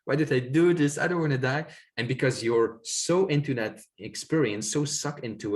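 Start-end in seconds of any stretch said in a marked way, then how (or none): 0.0-1.7 rising, pleading, desperate; panicked inner voice. Retold or imitated. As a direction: beg not to die, climbing higher. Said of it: "My mind was like".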